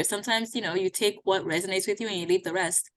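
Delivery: usual speaking voice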